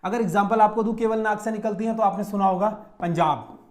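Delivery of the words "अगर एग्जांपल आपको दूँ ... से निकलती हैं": usual speaking voice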